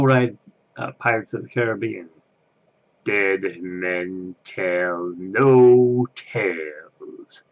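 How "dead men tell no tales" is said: low, very slow eerie voice; spooky pirate line. Retold or imitated. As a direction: growl it out like a ghostly narrator. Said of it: "he did some good voices".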